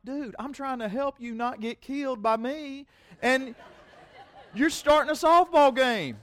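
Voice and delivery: high, slow, laughing disbelief at the umpire; pleading with the umpire, half laughing; exasperated retelling. Retold or imitated.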